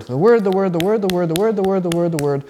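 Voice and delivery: "word" stressed again and again; repeated emphasis. Shown as a demonstration. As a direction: keep punching "the word" over and over. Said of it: "if you're emphasizing the word".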